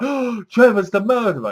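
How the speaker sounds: a viewer's jolt of surprise; mock realization. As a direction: gasp out the twist. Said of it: "You're like".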